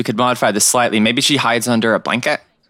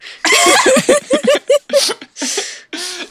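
long high-pitched laugh. Real, not acted.

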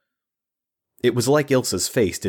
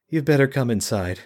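defeated; 6 semitones higher than usual. Acted. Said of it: "was defeated, seemingly devoid of hope".

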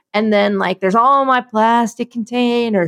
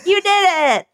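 high, sing-song pat on the back; mock self-praise; 8 semitones higher than usual. She mimics giving herself a sticker, high and sing-song. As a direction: praise yourself like a kindergarten teacher.

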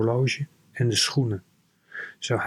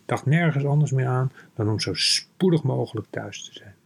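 plain reading voice

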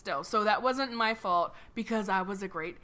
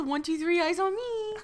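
high, singsong call for attention; kindergarten teacher voice; 8 semitones higher than usual. Pretend. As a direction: count the kids to attention. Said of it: "You worked in a daycare".

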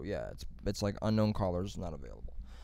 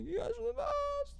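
mock gushing thanks; 4 dB quieter than usual.

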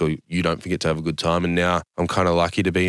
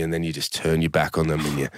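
ordinary talk, nothing marked

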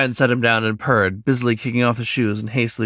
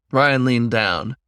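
ordinary delivery, nothing marked

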